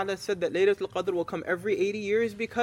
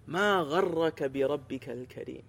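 Quranic recitation; 4 semitones lower, much faster than usual. Acted. Preacher reciting the verse to the congregation, recitation. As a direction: recite the verse in measured tajweed.

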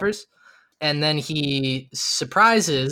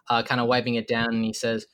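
ordinary talk, nothing marked

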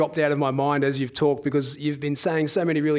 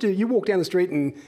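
normal talking voice

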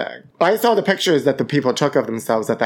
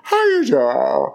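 Wendy Williams catchphrase; much slower than usual. Retold or imitated. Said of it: "Wendy Williams' birthday".

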